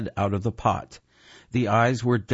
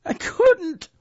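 very high, frightened squeak; 4 dB louder than usual. A narrator's reading. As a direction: squeak it out in panic. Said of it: "Oik squeaked".

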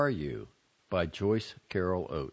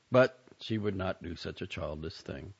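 usual speaking voice

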